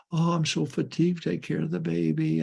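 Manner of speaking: high mock whine of a worn-out parent; high, whiny mock moan; mock fatigue. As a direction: mimic a self-pitying complaint. Mocking a tired parent.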